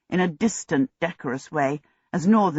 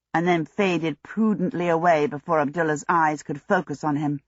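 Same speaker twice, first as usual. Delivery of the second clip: ordinary delivery, nothing marked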